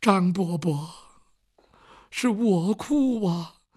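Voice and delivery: ghostly pot voice. Acted. The haunted pot speaks to Uncle Zhang, slow and eerie. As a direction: drag it out like a wronged spirit. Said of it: "这盆儿又说话了".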